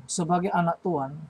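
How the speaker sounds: ordinary delivery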